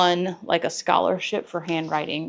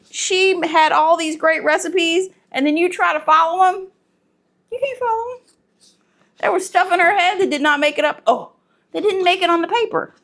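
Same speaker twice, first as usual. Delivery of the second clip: exasperated; 9 semitones higher, 4 dB louder than usual. Real, not acted. Exasperated about grandma's unwritten recipes.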